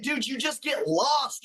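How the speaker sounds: ordinary delivery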